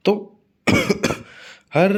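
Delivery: ordinary talk, nothing marked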